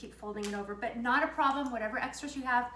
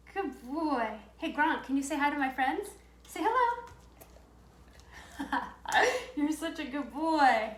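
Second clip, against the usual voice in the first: talking to a dog; 3 semitones higher, 4 dB louder than usual. Real, not acted. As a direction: coo at the dog lovingly. Praising dog on camera.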